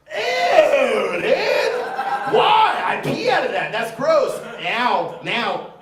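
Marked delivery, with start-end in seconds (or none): 0.1-4.4 high, loud squeal of revulsion; acted kid disgust. Thirteen-year-old to friend who explained it, hammed-up disgusted squeal. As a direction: recoil in hammed-up horror, voice shooting up. Young self disgusted on learning meaning.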